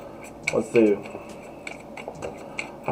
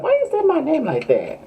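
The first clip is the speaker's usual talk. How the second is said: much higher put-on voice of an annoyed coworker; high offended coworker. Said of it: "she was like".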